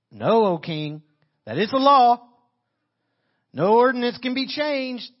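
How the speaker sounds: a hint of officials pressing the king; pushy officials. Officials pressing the king to enforce the law, insistent.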